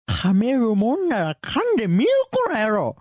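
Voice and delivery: loud, high, hammed-up taunt; angry threat. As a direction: overdo the menace. Acted threat retake, overdone.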